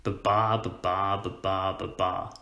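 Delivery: "Ba-ba" four times, weak then strong each time; even iambic bounce on "Ba-ba", stressing every second syllable; bouncing rhythm. Shown as a demonstration. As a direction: chant "Ba-ba, ba-ba, ba-ba, ba-ba" as ba-BA. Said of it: "this kind of bouncing rhythm".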